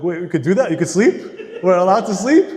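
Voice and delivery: can't believe it, laugh as you ask; laughing disbelief. Retold or imitated. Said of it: "And like".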